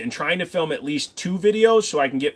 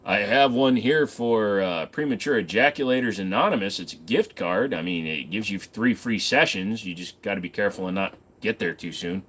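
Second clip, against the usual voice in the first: usual speaking voice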